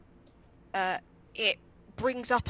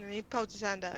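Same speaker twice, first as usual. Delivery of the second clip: usual speaking voice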